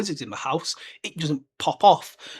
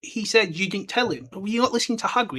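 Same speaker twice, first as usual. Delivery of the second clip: ordinary talk, nothing marked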